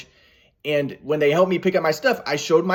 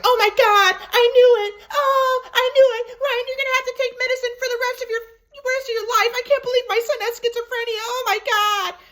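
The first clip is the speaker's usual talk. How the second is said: much higher, far-off wailing mother voice, tearful on the repeated line; hysterical mom. Retold or imitated. Mother wailing to her son about his diagnosis, high and tearful. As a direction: mimic a panicking mother, pitched way up and sobbing.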